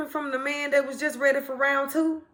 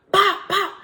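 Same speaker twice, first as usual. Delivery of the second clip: punch sounds; 7 semitones higher, 7 dB louder than usual. Retold or imitated. Retelling punches in a fight.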